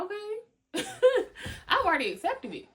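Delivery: high, loud laughing brush-off; breezy laughing shrug. Pretend. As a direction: laugh off the gossip, already over it. Unbothered reply to gossip.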